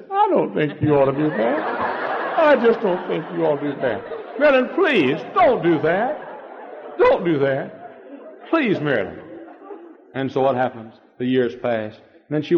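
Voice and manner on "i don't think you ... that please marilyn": high, pleading voice with a laughing catch; begging her not to, half crying; a husband's tearful plea, voiced high. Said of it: "And he begins to cry".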